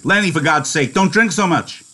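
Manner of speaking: sharp, high-pitched scolding. Acted. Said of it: "he said sharply".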